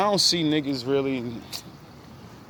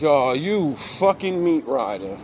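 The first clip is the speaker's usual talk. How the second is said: slow, high mock outrage; calling out a meat-rider friend; disgusted disbelief; 3 semitones higher, slower than usual. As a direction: drag it out in betrayed disbelief. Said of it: "And I'm like".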